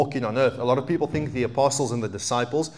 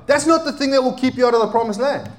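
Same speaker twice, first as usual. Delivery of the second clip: raised high, loud preacher's proclamation; preaching at full force; 10 semitones higher, 3 dB louder than usual. Acted. Preacher declaring to the congregation, raised and emphatic. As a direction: hammer home that it is unbelief.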